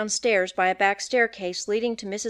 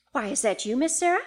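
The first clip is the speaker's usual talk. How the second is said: mildly surprised housekeeper, lifting at the end; exclaimed; 4 semitones higher than usual. A narrator's reading. Housekeeper greeting Miss Sarah on waking, exclaimed. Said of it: "she exclaimed".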